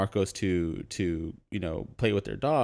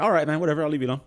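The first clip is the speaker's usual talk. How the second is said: shrugging whatever; 5 semitones higher, 4 dB louder, much faster than usual. Retold or imitated. Man gives up on the request.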